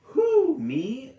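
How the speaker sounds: ordinary delivery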